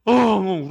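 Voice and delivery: loud, drawn-out mock sob; brief acted wail; imitated loud wail. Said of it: "막 큰 소리로".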